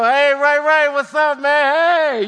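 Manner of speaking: loud, high, slow street greeting to a neighbor. Pretend. Preacher plays greeting neighbor Ray Ray, loud and high. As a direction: hail your buddy across the street.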